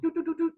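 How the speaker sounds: high, even toot-like beeps; phone dialling beeps. Retold or imitated. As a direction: imitate keypad tones.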